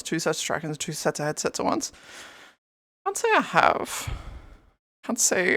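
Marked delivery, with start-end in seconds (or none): 3.0-4.1 much higher, slow, mock-prim reply; silly high answer. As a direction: answer drawn out in a high put-on voice. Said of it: "Have you ever tried".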